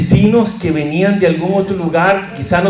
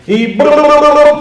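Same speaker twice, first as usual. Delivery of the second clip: loud rolled brr like a machine gun; machine-gun noise; 9 semitones higher, 6 dB louder than usual. Retold or imitated. Teacher mimicking rushed preachers cramming in a sermon, machine-gun rattle. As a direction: rattle off rapid rolled bursts, pitched up. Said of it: "parecía una ametralladora".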